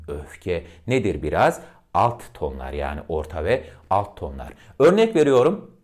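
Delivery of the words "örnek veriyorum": normal talking voice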